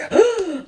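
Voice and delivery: a startled high gasp, sharply drawn in. Retold or imitated. Waking to a stranger, a sharp high gasp. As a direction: gasp as if just caught off guard. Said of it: "And I was like".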